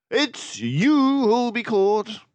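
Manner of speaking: high, loud, slow and stern; stern detective. Acted. Basil sternly warning the crooks. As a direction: warn them firmly. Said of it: "said Basil sternly".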